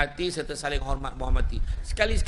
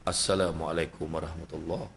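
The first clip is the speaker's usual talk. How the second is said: deep, hushed closing greeting of prayer; low murmured prayer salam; 13 semitones lower, 9 dB quieter than usual. Acted. Husband ending his prayer, low murmured salam. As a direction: turn the head and murmur it low.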